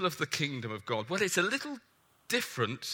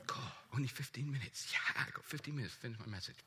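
much softer, fast aside to himself; muttered aside; 5 semitones lower, 12 dB quieter, faster than usual. Real, not acted. Preacher noticing only fifteen minutes left, softly muttered. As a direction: drop the voice and rush a private aside.

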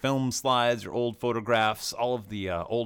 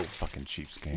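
low, soft mock grumble under breath; mock mutter; 9 semitones lower, 9 dB quieter, slower than usual. Friend teasing the gift giver, low mock mutter. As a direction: grumble it so he barely hears. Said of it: "what are you mumbling about".

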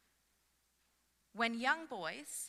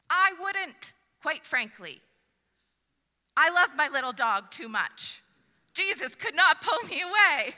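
emphatic; 5 semitones higher, 11 dB louder than usual. Real, not acted. Joking refusal to follow.

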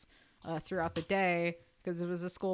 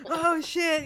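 higher, louder scandalised reaction; mock shocked crowd; 9 semitones higher, 5 dB louder than usual. Imitating the school crowd at the reveal, mock shock. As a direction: react like a stunned audience. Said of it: "the entire crowd goes".

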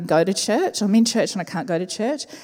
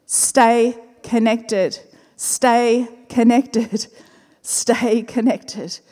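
very slow, measured repetition; drawn-out key message to a congregation; slow, deliberate emphasis; much slower than usual. Real, not acted. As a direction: let each word land slowly.

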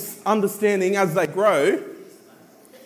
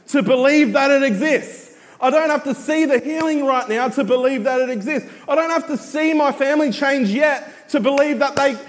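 preaching; 6 semitones higher than usual. Acted. Preaching at full force.

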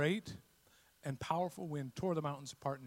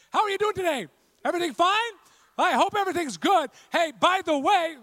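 high, very loud hollered chit-chat; mock shouted small talk; 14 semitones higher, 16 dB louder than usual. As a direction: holler absurdly, as if they weren't right beside you. Shouting at someone sitting right beside.

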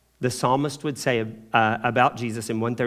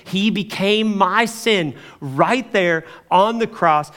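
higher, louder, slow emphatic preaching; slow preaching emphasis; 6 semitones higher, 6 dB louder, slower than usual. Real, not acted. Preacher stressing to his class that Jesus became sin, slow and loud. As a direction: press each word home to the congregation.

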